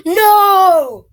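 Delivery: high, loud gasp and cry of no; shocked by the falling toy; real surprise cry; real gasp of dismay. Real, not acted. Pikachu figure fell.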